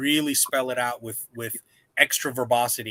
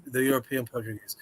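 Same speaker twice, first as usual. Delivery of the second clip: usual speaking voice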